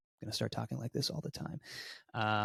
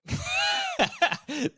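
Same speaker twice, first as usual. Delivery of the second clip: giggle; 9 dB louder than usual. Real, not acted. Teasing location reveal.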